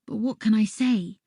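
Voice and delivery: ordinary delivery, nothing marked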